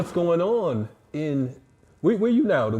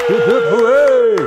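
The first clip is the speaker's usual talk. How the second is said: cheering; 8 semitones higher, 10 dB louder, slower than usual. Pretend. Cheering the guest's entrance.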